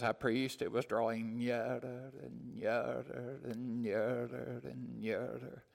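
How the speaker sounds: lightly imitated Welsh accent, slow and drawn out; slow Welsh-accented retelling, trailing into quiet; Lloyd-Jones. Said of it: "in his Welsh accent saying".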